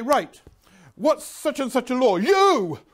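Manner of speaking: loud, high, demanding shout ending on a pointed You!; shouting teacher. Retold or imitated. Teacher Jones picking on a pupil, loud bark. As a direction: jab the question at a pupil and single him out.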